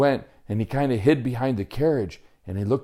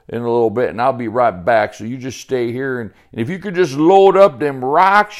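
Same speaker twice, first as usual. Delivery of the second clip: loud, higher, folksy country character voice; hearty backwoods Sebastian, bossy; 4 semitones higher, 7 dB louder, faster than usual. Acted. Sebastian leaves Joey to load the rocks, hearty and hurried. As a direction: rattle off instructions like a busy woodsman.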